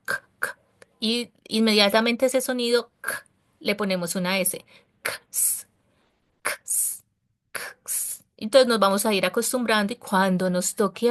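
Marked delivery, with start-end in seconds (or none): 0.1-0.6 k, k said without voice, short and crisp; isolated k sound. Shown as a demonstration. Teacher demonstrating the k sound alone, unvoiced. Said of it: "ahí es como suena la K". 5.0-8.2 Ks, ks, ks whispered, softer and softer; drilled ks sound. Shown as a demonstration. Teacher drilling the ks ending for students, whispered. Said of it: "así es como suena la K".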